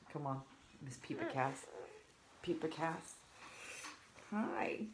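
sing-song prompt. Real, not acted. Prompting toddler guest.

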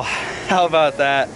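ordinary delivery

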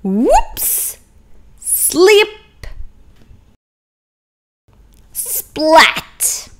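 high, punchy cartoon effects; storyteller voicing the slip and splat; playful sound words. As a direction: pop each sound word out.

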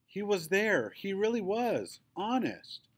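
lightly voiced girl, high and insistent; a hint of a girl begging to be believed; pleading little girl. A narrator's reading. Quinny insisting to Daddy.

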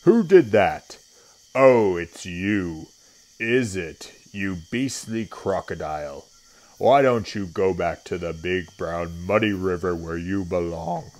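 big, loud, scolding elephant; Trunky's big deep voice. A narrator's reading. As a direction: boom it out as an annoyed elephant. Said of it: "said Trunky in his big, deep voice".

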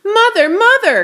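high, loud urgent call; boy running into the castle to mother. A narrator's reading. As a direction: call her as you burst in. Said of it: "called out Radius".

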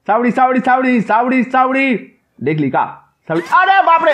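high, loud squealing sorries ending in a cry; frantic apology. Real, not acted. Player caught again by the nun, high squealing apologies and a cry. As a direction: beg her to stop as she catches you again.

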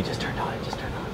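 hushed whisper. Real, not acted. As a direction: whisper so he won't hear. Whispered while spying.

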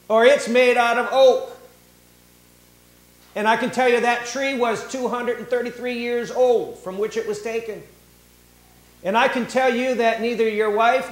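high, loud, accusing voice; pretend angry confrontation. Preacher voicing what Jesus did not say to the centurion, loud and accusing. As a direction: call him out like a know-it-all accuser.

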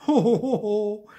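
loud, high "ho ho" laughter; mocking laugh. A narrator's reading. Carved mouth ignoring the order, hooting laugh. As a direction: hoot with laughter, high and cheeky. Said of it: "lachte der Mund".

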